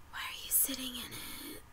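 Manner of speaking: voiceless, very soft question; acted whisper. Girl confronting someone in her seat, incredulous whisper. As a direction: whisper it in disbelief.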